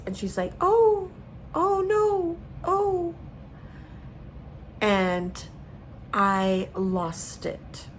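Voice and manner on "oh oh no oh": very high, drawn-out helpless "oh"; imitated female onlooker. Woman filming a child's shark attack, imitated high wail. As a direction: mimic a woman wailing much higher and louder. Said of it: "It's a female's voice".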